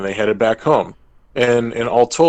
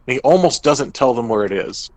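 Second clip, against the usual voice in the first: ordinary talk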